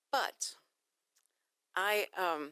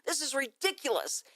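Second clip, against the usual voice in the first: louder, higher yelling for an operator; higher, louder outraged caller; furious demand; 6 semitones higher, 5 dB louder than usual. Retold or imitated. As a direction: rant at the phone system. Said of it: "But I said".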